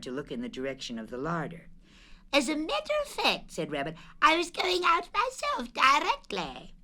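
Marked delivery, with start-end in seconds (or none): 2.3-3.5 high, lively Rabbit voice; high fussy rabbit; Rabbit's voice. Acted. Rabbit voiced in read story. 4.2-6.7 high, lively Rabbit voice; high fussy rabbit; Rabbit's voice. Acted. Rabbit voiced in read story.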